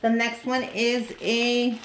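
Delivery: usual speaking voice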